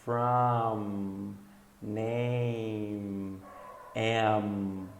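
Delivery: from, name, am, each trailing into a long hum; drawn-out final M. Shown as a demonstration. Teacher gives M examples, from, name, am with hummed endings. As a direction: close the lips and hold the M of from, name, am. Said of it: "com M".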